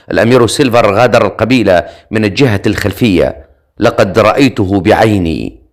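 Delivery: plain reading voice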